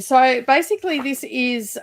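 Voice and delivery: ordinary delivery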